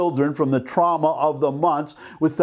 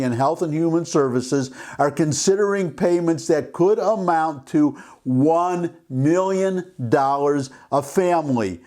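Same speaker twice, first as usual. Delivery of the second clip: usual speaking voice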